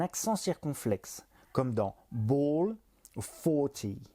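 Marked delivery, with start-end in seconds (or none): 2.1-2.8 "ball" with a long, deep O; deep vowel. Shown as a demonstration. Teacher illustrating deep sounds, long O in "ball". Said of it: "les sons graves comme E ou O". 3.2-4.0 "forty" with a deep O in the first syllable; dark open O in "forty"; deep vowel. Shown as a demonstration. Teacher illustrating deep sounds, deep O in "forty". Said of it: "les sons graves comme E ou O".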